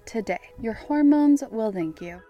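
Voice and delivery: loud, upbeat sponsor read. Acted. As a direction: sell it like a radio ad. Host reads sponsor ad.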